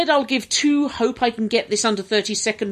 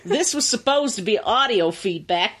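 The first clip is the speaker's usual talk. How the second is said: slow, laboured mock American; bad American accent; 2 semitones higher than usual. Host reading an American listener's email, badly faked American accent. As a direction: attempt an American accent and drag it out. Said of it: "I've got to do it in the accent".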